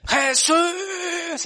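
high, pleading call to Jesus; desperate cry out. Retold or imitated. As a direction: call his name from far off, begging. Blind man crying out to Jesus.

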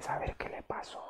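шёпотом, как сплетничают прохожие; whisper. Retold or imitated. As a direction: imitate bystanders whispering about someone crying. People whispering pity about a crier.

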